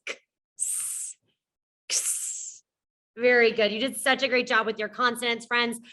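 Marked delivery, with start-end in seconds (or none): 0.1-2.2 unvoiced ks, much softer; X sound. Shown as a demonstration. Teacher modelling the X sound, whispered ks. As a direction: breathe out a ks. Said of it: "hear both of those sounds".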